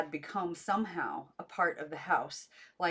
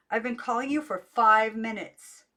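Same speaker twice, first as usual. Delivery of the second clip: louder, higher reproach; loud exasperated reproach; angry scold; 5 semitones higher, 10 dB louder than usual. A narrator's reading. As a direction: scold her for not answering. Aunt scolding furiously.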